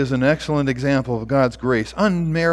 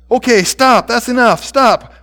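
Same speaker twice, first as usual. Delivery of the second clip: loud, high desperate protest; urgent plea; 9 semitones higher, 7 dB louder than usual. A narrator's reading. Boy tries to stop the principal's beating, desperate. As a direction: cry out to halt it, each phrase punched.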